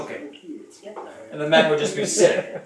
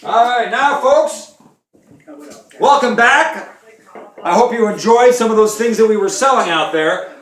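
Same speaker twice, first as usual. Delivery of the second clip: 1 TV host welcome back; 5 semitones higher, 6 dB louder than usual. Acted. Host returns from a break.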